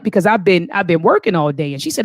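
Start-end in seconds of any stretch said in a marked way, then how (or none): none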